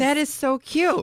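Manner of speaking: high, slow, drawn-out gush. Real, not acted. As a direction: coo it like it melts you. Gushing over hoodie bottles.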